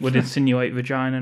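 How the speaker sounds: normal talking voice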